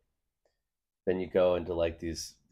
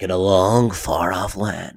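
loud, slow epic trailer narrator; movie trailer voice; 7 dB louder, slower than usual. Acted. Trailer guy starts his spiel, in movie trailer voice. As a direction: open an epic trailer, stretching the words. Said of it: "The movie trailer voice?".

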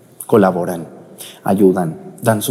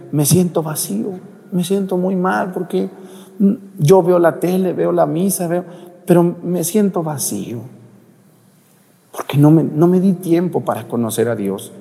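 tearful sick man; 8 semitones higher than usual. Retold or imitated. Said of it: "ese señor estaba llorando".

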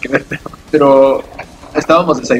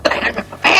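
distant yell; 7 semitones higher, 5 dB quieter than usual. Retold or imitated. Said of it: "Escuchaba como gritaba".

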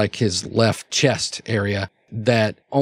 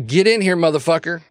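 high, raised-voice summons; calling out; 7 semitones higher, 3 dB louder than usual. Acted. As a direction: holler for someone through the house. Claude yelling unknowingly over the intercom.

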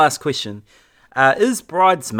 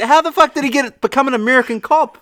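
high-pitched disbelief, half laughing; incredulous outburst; 12 semitones higher, faster than usual. Real, not acted. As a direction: pitch it way up in mock outrage. Ranting disbelief about the Irish cop.

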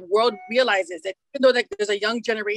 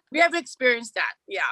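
normal talking voice

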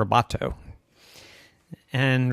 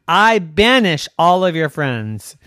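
loud decree; 3 semitones higher, 7 dB louder than usual. Acted. Evan banishes the friends.

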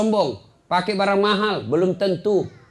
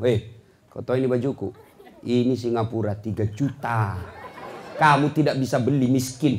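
low, hushed snobbish bragging; acted arrogance; 6 semitones lower than usual. Show-off belittling a poorer neighbour over clothes, low and arrogant. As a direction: look down on the listener, voice dropped low. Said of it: "Ah, kau sombong".

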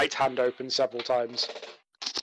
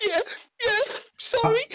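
put-on high, snivelling child apology; a scared halfling boy, still snotty; sniffling scared kid. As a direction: apologise meekly through tears. GM keeps voicing sniffling child.

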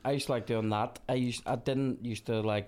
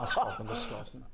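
laughing disgust; 12 semitones higher, 3 dB quieter than usual. Real, not acted. Laughing at a missed chance.

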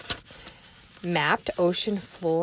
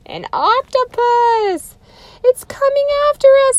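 much higher, louder screaming alarm; panicked Annie; 8 dB louder than usual. Acted. Teacher voicing Annie terrified of the octopus. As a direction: shriek it in panic.

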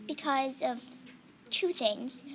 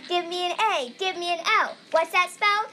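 loud, high cheerleader chant; 5 semitones higher, 5 dB louder than usual. Pretend. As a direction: pump up the crowd with a cheer. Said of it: "a cheerleader cheer".

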